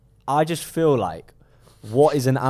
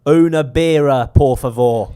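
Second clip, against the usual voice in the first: louder and slow, overpronounced tourist Spanish; 4 semitones higher, 12 dB louder, slower than usual. Pretend. Mocking English tourists ordering abroad, loud and slow. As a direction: order a beer like a Brit abroad who thinks volume helps. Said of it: "talk loudly".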